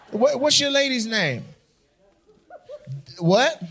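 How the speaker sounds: ordinary delivery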